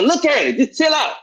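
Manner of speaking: high-pitched retold plea to calm down; quoted friend, high and insistent; imitated urgent pleading. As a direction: pitch it up as the friend talking him down. Voicing friend calming him down.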